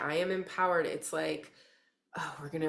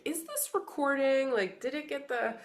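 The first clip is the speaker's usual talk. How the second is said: high-pitched mock fretting; retold anxious checking; 8 semitones higher than usual. Reader replays checking whether it is recording, retold fretting. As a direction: act out your own flustered checking. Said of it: "And I'm, like, checking".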